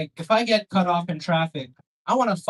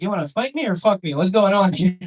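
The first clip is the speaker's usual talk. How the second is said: louder, higher puzzled voice; confused driver; 3 semitones higher, 6 dB louder than usual. Retold or imitated. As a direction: play the baffled local driver. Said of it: "They're like".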